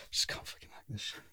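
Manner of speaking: very soft, voiceless grumble; muttering dad. Retold or imitated. Friend's sweating dad after coming downstairs, muttering. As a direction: imitate a worked-up dad muttering under his breath. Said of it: "his dad's just like".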